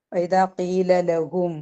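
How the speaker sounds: slow, drawn-out tajweed recitation; slow Arabic recitation; Quranic recitation. Shown as a demonstration. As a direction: recite each syllable slowly, as in Quran reading. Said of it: "തജ്വീദിന്റെ നിയമമൊന്നുമില്ല".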